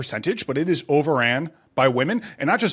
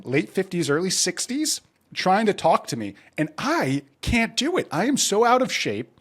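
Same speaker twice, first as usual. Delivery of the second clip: ordinary talk, nothing marked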